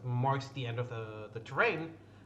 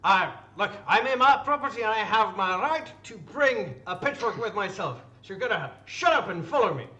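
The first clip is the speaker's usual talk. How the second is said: indignant farmer; 6 semitones higher, 7 dB louder than usual. Acted. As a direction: lay down the law, loud and riled. Farmer insists on his pitchfork.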